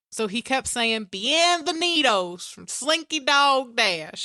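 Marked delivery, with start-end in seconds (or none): 1.1-4.2 high, drawn-out Slinky Dog drawl; Slinky Dog country accent. Retold or imitated. Retelling how a man in line impersonated the ride's voice, slow country accent. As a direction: imitate a slow country cartoon dog, pitched up. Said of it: "In Slinky Dog's accent".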